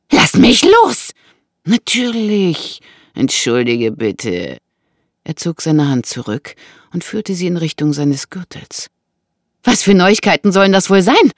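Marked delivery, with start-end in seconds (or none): 0.1-1.1 high, loud, drawn-out cry; desperate shout. Acted. Woman demands the intruder release her, desperate. Woman struggles to get free. 1.6-4.6 slow, drawn-out false courtesy; mock apology. Intruder releases woman with mocking apology, insincere. 9.6-11.3 high, loud, shrill question. A narrator's reading. Frightened woman asks what news he means, shrill. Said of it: "Ihre Stimme klang schrill".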